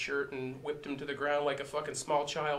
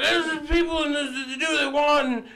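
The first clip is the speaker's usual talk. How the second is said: high mocking drunk ramble; mock drunk old man, higher and louder; drunk old guy voice; 11 semitones higher, 6 dB louder than usual. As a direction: imitate a slurring old drunk, pitched way up. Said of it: "He was like".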